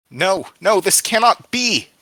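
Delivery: high, loud cry of disbelief; protesting scream. A narrator's reading. Captive about to be sacrificed, screaming in protest. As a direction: scream your refusal over the chanting.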